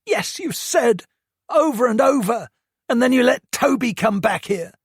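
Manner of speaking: high-pitched, loud furious rant; angry teen rant. A narrator's reading. Hurt son lists Toby's abuse to mum, furious and high. Josh's voice.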